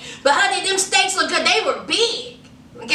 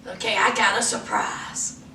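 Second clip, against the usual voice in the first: low, soft seductive man voice; hammed-up romantic whisper; 7 semitones lower, 6 dB quieter than usual. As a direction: drop it low and smooth like a lover. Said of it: "He was like".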